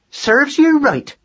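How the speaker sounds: very high, slow scolding call; scornful call. A narrator's reading. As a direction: call it down at the culprit. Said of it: "called Madeline".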